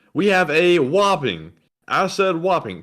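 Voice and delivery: WHOPPING stretched high and hard; emphatic announcement. Real, not acted. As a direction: announce it big, leaning on "whopping". Announcing a big donation emphatically.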